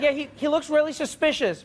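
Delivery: high-pitched, earnest caller; worried caller. Acted. Caller describing a suspicious man, worried. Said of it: "South African operators doing nine one one".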